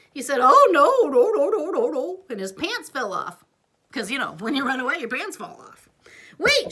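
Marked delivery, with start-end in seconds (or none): 0.4-2.2 much higher, loud panicky no's; frightened prince fleeing; scared. Acted. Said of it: "he got scared". 6.4-6.7 high loud call; shouted. Acted. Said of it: "she shouted".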